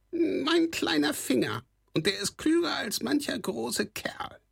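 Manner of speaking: a cocky little goblin showing off; smug kobold squawk. A narrator's reading. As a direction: brag in a squeaky goblin voice. Kobold boasts.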